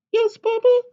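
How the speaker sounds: high, loud, eager chick's squeak; a tiny excited hen butting in; little chicken voice. Acted. Chicken bursting into papa's story, high and eager.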